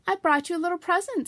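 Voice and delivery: eager, high and loud Pooh; bubbling over with the gift; excited. A narrator's reading. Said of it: "said Pooh excitedly".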